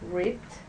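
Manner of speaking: "Ripped" with a crisp unvoiced t at the end; model word. Shown as a demonstration. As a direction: stress the final t in "Ripped", no voicing. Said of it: "So everybody say ripped".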